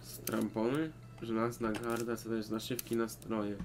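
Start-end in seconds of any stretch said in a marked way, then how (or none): none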